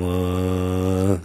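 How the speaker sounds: stiff flat corpse mumble; zombie voice. Acted. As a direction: groan it out like a shambling zombie. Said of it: "I'm doing my zombie voice there".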